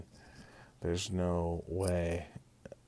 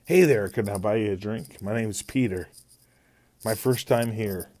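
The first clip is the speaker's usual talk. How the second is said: louder, brighter come-on to a stranger at a bar; polite pickup line; 3 semitones higher, 7 dB louder than usual. Pretend. Guy at a bar offering a stranger a drink, loud and eager. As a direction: chat her up politely, a bit louder.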